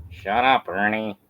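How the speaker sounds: ordinary delivery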